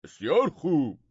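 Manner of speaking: grave royal assent; dead serious. A narrator's reading. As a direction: say it in all earnest. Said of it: "با لحنی کاملاً جدی گفت".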